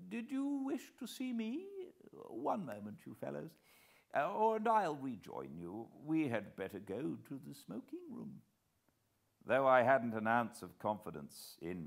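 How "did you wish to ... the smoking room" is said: put-on higher old gentleman, courteous and smooth; old host, polite. A narrator's reading. Old host speaks to Hannay, polite and higher. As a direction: usher the visitor away from his guests.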